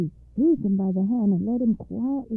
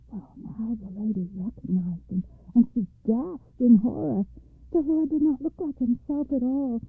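ordinary delivery, nothing marked

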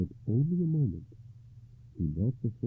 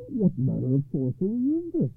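louder, higher cry of a shaken knight; hoarse cry; 11 semitones higher, 7 dB louder than usual. A narrator's reading. Knight faced with sorcery, crying out in shock. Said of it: "he cried hoarsely".

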